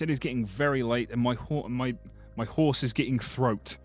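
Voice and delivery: ordinary delivery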